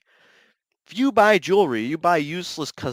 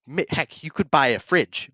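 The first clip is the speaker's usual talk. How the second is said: usual speaking voice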